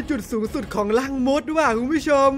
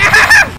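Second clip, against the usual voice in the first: burst of laughter; 10 dB louder than usual. Real, not acted. Laughing after surviving.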